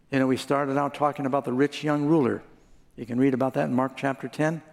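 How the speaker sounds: usual speaking voice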